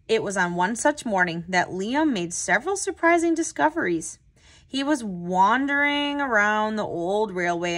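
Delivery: put-on steady read-aloud voice for young listeners; unhurried children's story narration; storybook narration. A narrator's reading. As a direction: read it like a picture book to a class. Reads picture book aloud.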